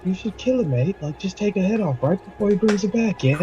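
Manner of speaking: high, voiced stage whisper in character; mock conspiratorial whisper. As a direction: egg them on in a high put-on voice. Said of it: "I whisper to him".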